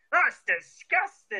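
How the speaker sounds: high, drawn-out mock disgust; wee Scottish lady. Imitating a wee lady in a TV interview, high and drawn out. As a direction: put on a shrill, slow wee-lady voice. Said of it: "you'll hear a wee lady and she'll be like".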